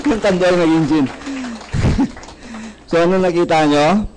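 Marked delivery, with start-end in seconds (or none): 1.1-2.8 laugh. Real, not acted. Laughing at gin joke.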